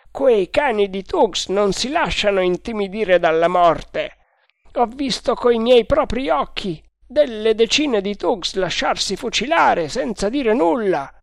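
the second guard brushes it off; doubting guard. A narrator's reading. (guardia incredula, voce alta e forte)

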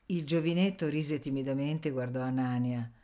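usual speaking voice

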